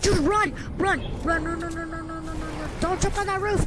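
high urgent repeated commands; panicking gamer. Real, not acted. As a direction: urge him to run, frantic. Fleeing zombies.

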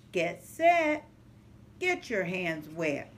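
plain reading voice

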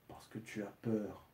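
lent et très doux, pour soi; pensée intérieure. A narrator's reading. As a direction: une pensée qu'on ne dit pas tout haut. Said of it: "pensa-t-il". (Montag pense sans parler, doucement et lentement)

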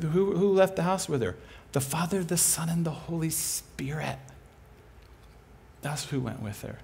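ordinary delivery

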